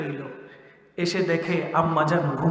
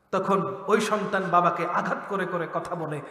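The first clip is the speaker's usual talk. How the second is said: usual speaking voice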